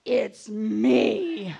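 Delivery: loud, menacing retold answer; hammed-up furious shout; angry announcement. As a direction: bellow it through a closed door. Furious answer through the door.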